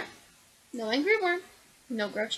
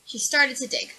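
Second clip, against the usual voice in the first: ordinary delivery, nothing marked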